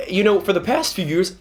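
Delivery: a light put-on Russian accent. Vlogger jokes to viewers in a light Russian accent. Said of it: "it's my Russian accent".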